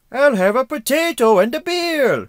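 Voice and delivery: hammed-up Irish accent, high and loud. American reviewer testing Harvey's accent, exaggerated bad Irish. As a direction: shout a bad, over-the-top Irish accent. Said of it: "I'm leaning more towards Irish".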